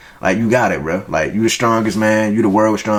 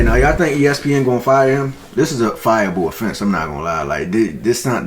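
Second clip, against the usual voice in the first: usual speaking voice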